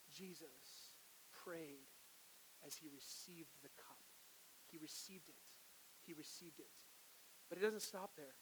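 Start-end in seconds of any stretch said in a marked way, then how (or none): 0.1-6.7 hushed. Acted. Hushed solemn preaching.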